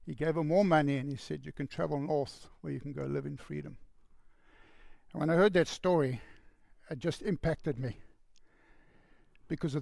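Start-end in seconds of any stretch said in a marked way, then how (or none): 1.5-3.8 soft, gentle and much quieter; a quiet, tender confirmation; tender reassurance. Retold or imitated. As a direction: reassure her almost under your breath. Man gently sends her north.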